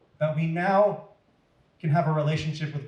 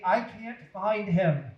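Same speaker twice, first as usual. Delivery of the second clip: urgent; 4 semitones higher, slower than usual. Acted. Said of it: "Mary said urgently".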